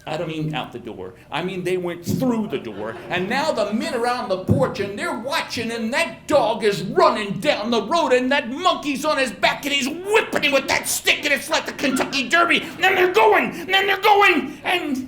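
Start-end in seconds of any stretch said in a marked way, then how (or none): 3.1-15.1 louder and higher, rising to a shout; excited building yell. Acted. Storyteller calling the chase to the audience with a building excited yell. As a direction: race through it like calling the Kentucky Derby.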